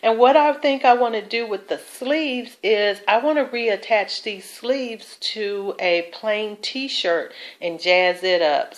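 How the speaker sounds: usual speaking voice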